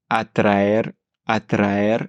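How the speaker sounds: "atraer" slow with a rolled r after t. Shown as a demonstration. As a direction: trill the r in "atraer". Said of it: "on a le tra".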